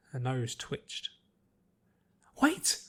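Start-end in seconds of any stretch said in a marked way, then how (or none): 2.4-2.8 sudden higher call; excited wait. Acted. Pinky hearing singing in the street, with a sudden excited wait. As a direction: stop yourself at a sound.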